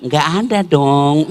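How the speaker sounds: slow, coy, teasing answer; a lingering flirt on the phone; mock coy reply. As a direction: play coy to the caller. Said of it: "bagaimana suaminya orang tidak tergoda".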